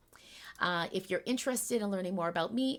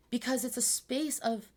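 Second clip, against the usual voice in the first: ordinary talk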